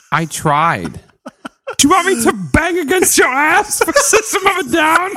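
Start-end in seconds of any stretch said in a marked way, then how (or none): 1.8-5.1 high mock voice.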